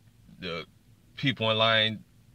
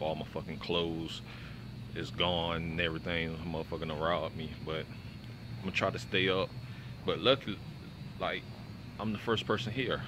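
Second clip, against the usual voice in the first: quiet voice; 3 semitones lower, 10 dB quieter than usual. Real, not acted. Hushed talk in line at 2am.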